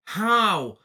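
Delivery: high, loud, slow incredulous demand; exasperated outburst. Acted. Co-host challenges Brian's impossible story, exasperated outburst. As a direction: drag out each word in loud disbelief. Mock outrage at film's plot.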